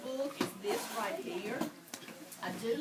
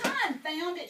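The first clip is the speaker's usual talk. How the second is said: mild shout; 5 semitones higher, 4 dB louder than usual. A narrator's reading. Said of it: "shouted Pete".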